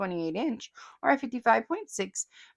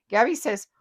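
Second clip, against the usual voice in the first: ordinary talk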